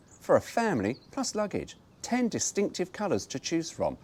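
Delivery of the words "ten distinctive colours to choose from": normal talking voice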